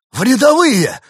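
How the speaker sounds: very high, loud dismayed cry; shocked outcry. A narrator's reading. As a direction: cry out at the demotion. Shocked echo of the demotion.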